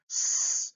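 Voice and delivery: "S" as a long hiss, not the letter name; isolated S sound. Shown as a demonstration. Teacher sounds out psychology's first sound, hissed S. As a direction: hold "S" as unvoiced air. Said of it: "P S as in psychology".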